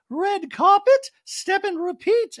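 high, loud and slow put-on; mock Dorit voice. Host mimicking Dorit about the screening, high and drawn out. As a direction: drag the words out high and grand.

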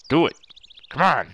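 normal talking voice

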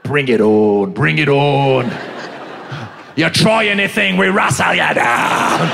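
low challenge building to a drawn-out finish; tough Aussie agent. Acted. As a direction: challenge them like an Australian wrestler. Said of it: "They like to wrestle".

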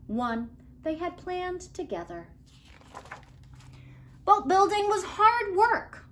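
ordinary delivery

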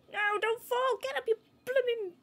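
high, loud frantic wail; shouting at the game. Real, not acted. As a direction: shout in exasperation as it falls. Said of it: "I'm just gonna be shouting at this".

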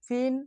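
ফিন as one syllable, "fin"; mistaken reading. Shown as a demonstration. Teacher asking which is correct, ফিন said with "i". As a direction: misread fine as ফিন with an "i" vowel. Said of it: "কোনটা সঠিক?".

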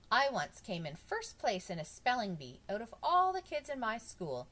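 mildly proud, voice lifted. A narrator's reading. Bob telling of his spelling bee win, proudly. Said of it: "Bob said proudly".

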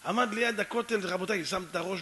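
normal talking voice

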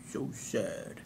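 a quiet, fake-sorry sigh of a line; mock sad. Coach mocking the client's sad news, softly.

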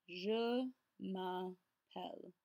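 Je m'appelle word by word, slowly; very slow pronunciation demo with gaps; careful slow model. Shown as a demonstration. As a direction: draw out and separate each part of Je m'appelle. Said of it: "focus on your pronunciation".

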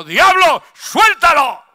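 loud, high rebuke of the devil; a young man rebuking the devil aloud; commanding shout. Retold or imitated. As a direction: cast him out with full force. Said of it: "que le dijo".

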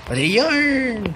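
high, loud, drawn-out wail; hammed-up, stretched cry of need; mock yarn craving. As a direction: wail for it like an obsessed cat.

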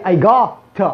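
"got" as an open "gah" for go; corrected open vowel. Shown as a demonstration. Teacher correcting I go, open vowel. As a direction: open "got" wide, no rounded o. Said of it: "هي".

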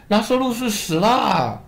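a hint of anxious followers; disciples' voice. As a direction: speak as the worried disciples.

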